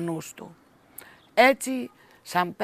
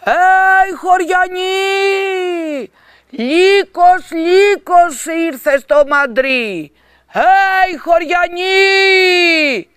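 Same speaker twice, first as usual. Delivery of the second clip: much higher, much louder, slow drawn-out cry; crying out through cupped hands; 10 semitones higher, 16 dB louder, slower than usual. A narrator's reading. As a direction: call through your hands like a horn. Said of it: "άρχισε να διαλαλεί".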